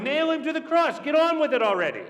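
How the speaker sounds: high, loud, impatient mob cry; crowd shouting. Acted. Mob urges Pilate to act at once, shouting impatiently. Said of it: "the crowd shouted for Jesus' blood".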